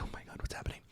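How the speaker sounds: hushed retold fright; frightened whisper. Guest at a haunted table feels chills, frightened whisper.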